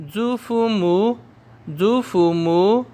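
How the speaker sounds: 祖父母 stretched syllable by syllable; very slow drill. Shown as a demonstration. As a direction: draw 祖父母 out slowly. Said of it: "मेरे साथ फिर पढ़िए".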